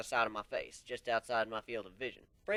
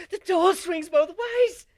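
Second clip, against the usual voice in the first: slow, much higher, full-throated scream; 17 dB louder, slower than usual. Acted. Terrified pony screaming at the swinging door. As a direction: yell it out in panic, dragging each word.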